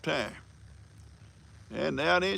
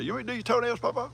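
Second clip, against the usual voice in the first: higher-pitched retold child's question; imitated young girl; 8 semitones higher, much faster than usual. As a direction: lightly mimic a girl asking Papa a favor. Retells young girl's offer.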